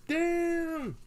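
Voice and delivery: high, stunned Damn!; shocked exclamation. Retold or imitated. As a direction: yelp it out in disbelief. Reacting to earthquake news.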